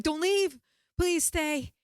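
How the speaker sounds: high, urgent acted cry; panicked pleading. Begging the helper to stay, panicked plea. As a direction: beg them desperately to stay.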